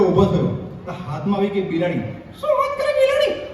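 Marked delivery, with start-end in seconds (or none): 2.4-3.5 squeaky mock listener. High squeaky interjection.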